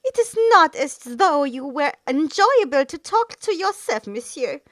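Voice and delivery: put-on high, loud French Orlesian accent curling around the words. A narrator's reading. The bard mocking the assassin in a French accent. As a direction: let the French accent curl like smoke. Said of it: "his Orlesian accent curling like smoke".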